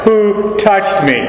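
"Who touched me?" high and slow, WHO held and stressed; emphatic. Acted. Christ questions the crowd, emphatic, high stressed WHO in "Who touched me?". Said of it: "it's emphatic".

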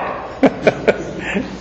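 a quick airy chuckle; soft breathy laugh. Real, not acted. Preacher recalling a funny skit, soft airy laugh. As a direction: laugh under the breath at the joke.

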